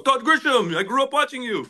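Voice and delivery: put-on high, bright Saudi Arabian accent. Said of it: "That was my Saudi accent".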